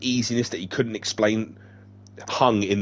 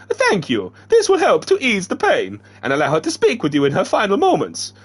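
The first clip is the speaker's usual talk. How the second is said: high, loud character voice; Dead Man voice; 10 semitones higher, 5 dB louder than usual. Acted. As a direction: voice Dead Man pitched well up. Dead Man speaks to Sam.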